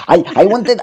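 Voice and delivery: put-on doing Jodorowsky's Spanish-accented English. Mocking a boastful director, Spanish accent. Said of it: "avec son accent espagnol".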